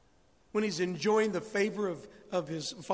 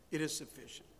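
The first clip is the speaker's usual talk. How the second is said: soft restatement; 4 semitones lower, 9 dB quieter than usual. Real, not acted. Preacher gently repeating to his congregation, softly. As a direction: drop to a soft, settled repeat. Quiet restatement after main line.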